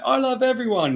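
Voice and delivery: high-pitched imitation of a stoned wife; mock yelling. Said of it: "yelling and screaming".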